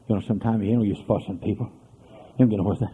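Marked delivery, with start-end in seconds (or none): none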